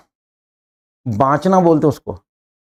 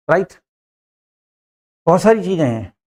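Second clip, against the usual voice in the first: ordinary talk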